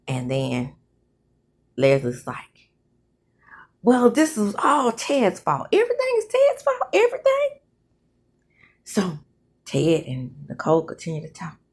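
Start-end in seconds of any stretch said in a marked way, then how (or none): 3.8-7.5 high-pitched, hurried mock complaint; exaggerated blaming. Woman pinning everything on Ted, high exaggerated complaint. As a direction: climb high and harp on it.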